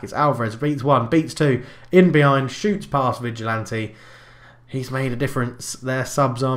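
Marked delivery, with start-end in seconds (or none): 0.0-3.9 rising, louder with each beat; excited commentary. Acted. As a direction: build the excitement like a live goal call. Live commentary on goal attack.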